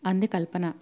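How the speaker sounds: ordinary delivery, nothing marked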